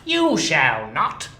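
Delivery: loud, slow, firm no; grumpy miser refusing; stern refusal. Acted. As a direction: shut him down coldly. Scrooge refuses clerk.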